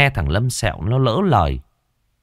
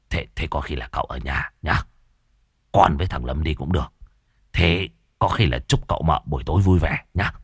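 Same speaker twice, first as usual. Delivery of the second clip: put-on low, softer, fawning old man's voice; sheepish old man; 6 semitones lower, 3 dB quieter than usual. A narrator's reading. Môn Phọt sheepishly excusing himself to the couple, low and soft. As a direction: wheedle your way out, low and soft.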